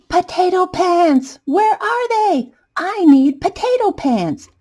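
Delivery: high, slow desperate calls; high frantic searching shout. Acted. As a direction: yell out searching for the pants. Potato storms in searching.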